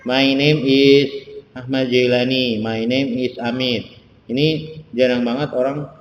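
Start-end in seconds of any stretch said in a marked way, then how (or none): 0.1-3.9 each English word spaced out slowly, like a teacher; slow model sentence. Shown as a demonstration. Teacher shows students how to introduce themselves, slow and clear. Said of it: "diisi dengan namanya masing-masing".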